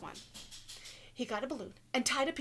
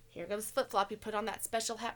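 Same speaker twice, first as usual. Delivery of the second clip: usual speaking voice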